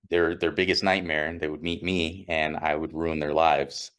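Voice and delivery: ordinary talk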